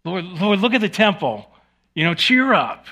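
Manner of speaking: high, loud pleading as the disciples; mock-eager disciples calling out to a grieving Jesus. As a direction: beg the Lord to look and cheer up. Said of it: "the disciples are like".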